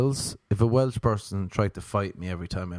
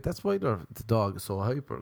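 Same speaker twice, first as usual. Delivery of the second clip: ordinary talk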